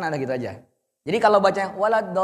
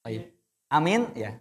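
normal talking voice

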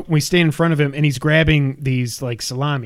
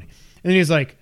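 usual speaking voice

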